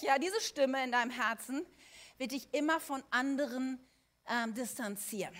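ordinary talk

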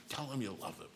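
a hint of a hushed, insistent nudge; Shirley's coaxing. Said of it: "she'd go like this".

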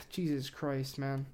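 ordinary delivery

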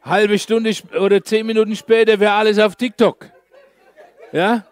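loud, amused aside with laughter; laughing joke. Real, not acted. Preacher joking to congregation about TikTok, laughing. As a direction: crack up at your own joke.

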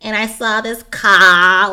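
loud, slow New York accent demo; exaggerated New Yorker. Shown as a demonstration. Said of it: "different kinds of New York accents".

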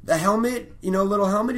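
retold fan clinging to hope; eager hopeful fan. Comic retelling Patton's bit, hopeful fanboy pleading. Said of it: "And he's like".

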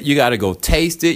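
ordinary talk, nothing marked

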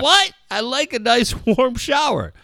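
put-on high, loud, whiny kid protest; son's outraged squeal. Son protesting to his dad, in a high whiny squeal. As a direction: squeak it out like an offended ten-year-old. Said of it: "He goes".

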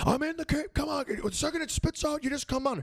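high-pitched imitated yell; yelling from the cave. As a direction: call out from inside a cave, high. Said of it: "you can hear him yelling".

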